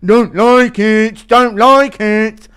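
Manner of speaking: high, loud, slow stubborn grumble; Menifield impression. Man refusing police orders, slow and loud. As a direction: drag it out, refusing to budge. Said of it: "Impression of Alonso Menifield".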